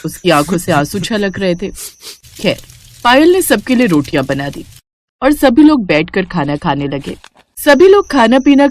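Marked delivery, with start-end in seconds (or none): none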